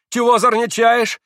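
put-on high, loud shout at the boy; angry shout. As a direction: yell at the prankster. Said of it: "закричал мещанин".

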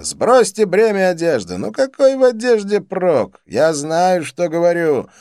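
high, loud, gleeful coaxing; exuberant Dean, urging. A narrator's reading. Dean coaxing two friends to undress, exuberant urging. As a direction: urge them on at full excitement.

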